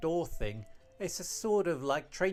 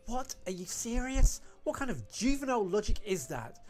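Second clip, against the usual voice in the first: higher-pitched indignant challenge; appalled disbelief; 7 semitones higher than usual. Acted. Scolding two friends over their careless logic, indignant. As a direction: throw it at them, incredulous.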